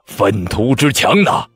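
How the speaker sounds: loud, slow, drawn-out bellow; exasperated shout, stretched out; furious bellow. A narrator's reading. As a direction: thunder each word in outrage. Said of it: "柳王天急至暴喝道".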